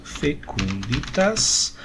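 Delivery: fecunditas drawn out syllable by syllable, very slow; slow Latin demo. Shown as a demonstration. Teacher showing the Latin cognate, slowly. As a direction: spell out fecunditas slowly for students. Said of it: "Aqui a gente tem um cognato".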